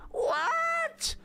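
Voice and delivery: very high, screechy hello; TV caller. Acted. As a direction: play a caller squealing down the line. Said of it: "We have a caller".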